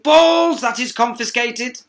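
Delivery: high, loud barking exclamation; outraged shout. Acted. Teacher confiscates marbles, loud high outrage. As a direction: grab them in a fury.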